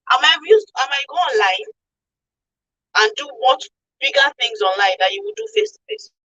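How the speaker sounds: ordinary talk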